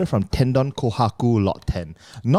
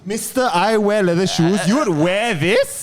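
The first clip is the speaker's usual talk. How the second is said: mocking voice; 6 semitones higher than usual. Mocking voice about shoes.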